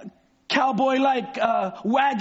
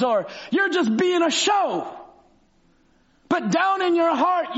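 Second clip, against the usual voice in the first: preaching build; 5 semitones higher than usual. Acted. Preaching at full force.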